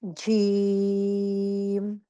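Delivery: जीम drawn out slowly, tongue middle on palate; slow jeem. Shown as a demonstration. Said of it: "touching the middle part of the palate, like". (धीमे खींचकर जीम)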